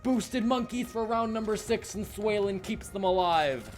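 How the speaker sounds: loud, high, excited clutch call; esports caster. Real, not acted. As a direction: build up and scream the final kill. Caster calling a clutch ace.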